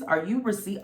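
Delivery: ordinary talk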